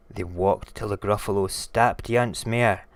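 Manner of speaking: ordinary delivery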